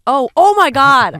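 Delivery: oh my God, shouted in shock; loud shocked cry; loud outburst. Real, not acted. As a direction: blurt it out, loud and slow.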